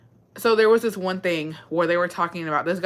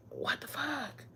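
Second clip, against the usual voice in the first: much softer, higher, breathy aside; muttered shock at how men think; stunned disbelief; 7 semitones higher, 15 dB quieter, slower than usual. Retold or imitated. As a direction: barely get the words out. Disbelief at men's views.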